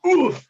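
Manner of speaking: high, loud grunt of effort; strained oof. Acted. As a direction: grunt under the weight. Lifting a hundred-pound harmonica.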